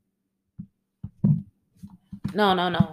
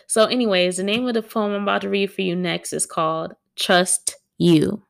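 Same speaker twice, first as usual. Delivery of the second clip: ordinary talk, nothing marked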